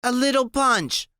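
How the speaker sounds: high, slurred tipsy echo; slurpy drunk. Acted. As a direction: let the words slide out drunk. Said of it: "her voice is slurpy".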